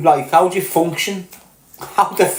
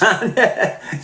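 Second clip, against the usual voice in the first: laughing; 5 semitones higher, 4 dB quieter, slower than usual. Real, not acted. Laughs at own joke.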